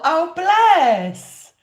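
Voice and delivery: ordinary delivery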